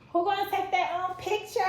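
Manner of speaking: high, loud excited squeal. Real, not acted. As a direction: squeal it out with delight. Excited unboxing, squeals question.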